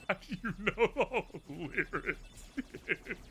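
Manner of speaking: talking through laughter; high, laughing disbelief. Real, not acted. Laughing while speaking.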